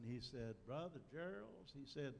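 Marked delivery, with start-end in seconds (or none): 0.6-1.7 higher-pitched Southern drawl imitation; put-on Southern accent, pitched up. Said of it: "in his southern accent".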